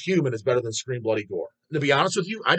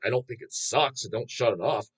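ordinary talk

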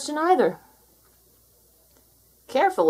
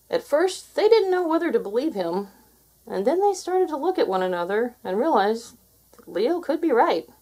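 ordinary delivery

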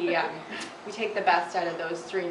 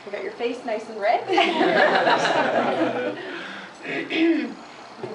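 laughing; 4 semitones higher, 8 dB louder, slower than usual. Real, not acted. Tester laughs after the blow.

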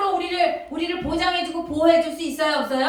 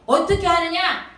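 ordinary talk

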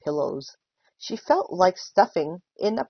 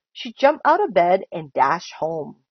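ordinary delivery, nothing marked

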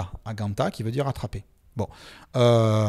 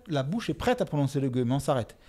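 ordinary talk

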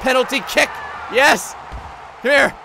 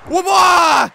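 loud, high whooping roar; playful battle cry; 7 semitones higher, 6 dB louder than usual. Pretend. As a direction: yell as you launch it. Battle noise while throwing.

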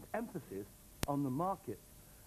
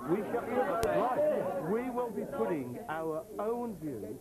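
firm declaration; 6 semitones higher, 3 dB louder, slower than usual. Real, not acted. Councillor forced to state his vote.

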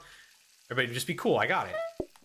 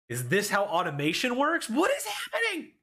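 a much higher, squeaky cry of disbelief; amazed; 10 semitones higher than usual. Real, not acted. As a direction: gasp it out in wonder. Amazed at automation.

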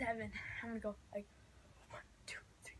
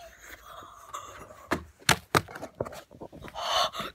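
sharp cold gasp; 9 dB louder than usual. Real, not acted. Cold snow shock.

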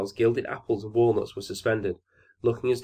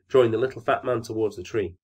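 ordinary delivery, nothing marked

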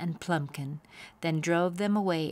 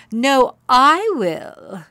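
slow, higher and much louder; insistent shout; 6 semitones higher, 11 dB louder, much slower than usual. A narrator's reading. Rival pony insisting she will win, loud. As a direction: cut her off and claim it. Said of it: "said Plumpkin".

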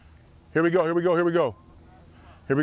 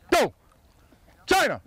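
high sharp shouted call; yelling from the sidelines; 8 semitones higher than usual. Real, not acted. Coach shouting call at drill.

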